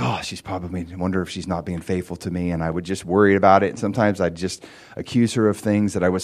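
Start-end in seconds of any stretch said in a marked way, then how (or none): 0.0-2.5 soft, fast and worried; anxious inner voice. Retold or imitated. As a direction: mutter the suspicion to yourself.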